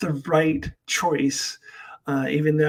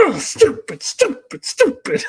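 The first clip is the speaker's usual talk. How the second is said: much higher, louder self-scolding groan of stupid; Chris Farley; 13 semitones higher, 4 dB louder than usual. Retold or imitated. [name] kicking herself after a slip, a Chris Farley bit, much higher voice. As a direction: smack yourself, stupid stupid stupid. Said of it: "does a Chris Farley".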